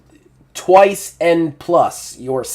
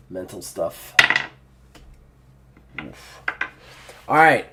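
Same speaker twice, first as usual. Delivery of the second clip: ordinary delivery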